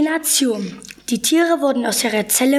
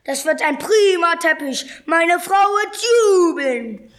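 raised, drawn-out gloating; gloating hunter; 6 semitones higher than usual. A narrator's reading. Huge attacker eyeing a zebra as a carpet, gloating. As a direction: relish it, stretching the last words high.

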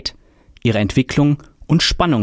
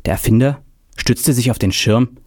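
ordinary delivery, nothing marked

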